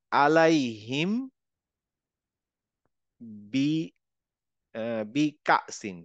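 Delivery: slow drawn-out Quranic reading; slow recitation. Shown as a demonstration. Teacher reads the verse slowly to students. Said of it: "এখানে দেখেন".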